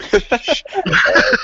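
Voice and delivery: high, mock "shut up"; mock dismissive. Telling his flat-earth friend to shut up, high and mocking. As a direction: brush him off in a squeaky put-on voice. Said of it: "I'm like".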